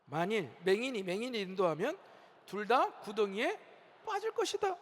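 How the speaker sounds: congregation-led recitation of a verse; reading a Bible verse aloud together; reading scripture aloud together. Real, not acted. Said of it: "다 같이 한번 읽어봅시다".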